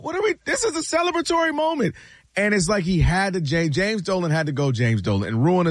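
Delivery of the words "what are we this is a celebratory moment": mocking high voice. Host mocking the owner's whine at a parade. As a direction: jump way up, mock whiny pitch. Imitating Dolan's complaint.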